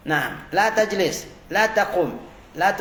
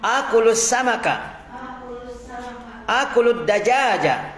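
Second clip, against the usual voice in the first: model reading; 4 semitones higher than usual. Shown as a demonstration. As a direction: read it out for the class to copy. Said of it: "kita baca dulu ya". (bacaan contoh yang jelas)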